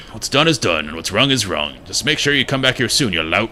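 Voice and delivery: put-on accent, explaining the shrine; acted, crusty character reading; grumpy bell-side character. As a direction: play a grumbling game character in a loose accent. Voices the game character's dialogue.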